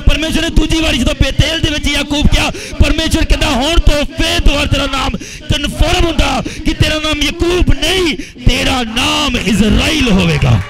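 rousing preacher's shout; full-force preaching. Real, not acted. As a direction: proclaim it to the congregation, stretching the final declaration.

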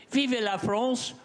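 put-on French accent, high and drawn out, defiant; proud final French flourish; French cry. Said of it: "I'm not going to die".

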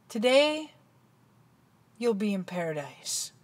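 soft and hushed, sinking low at the end; quiet, calm reassurance. Acted. Jesus on the cross to the thief, very calmly. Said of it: "Very calmly".

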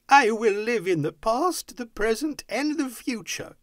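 high, fervent vow; excited high-pitched exclaiming; elated Scrooge. A narrator's reading. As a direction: proclaim it with joyful resolve. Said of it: "Scrooge repeated, as he scrambled".

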